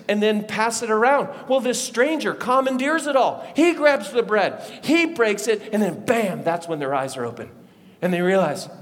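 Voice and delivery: ordinary delivery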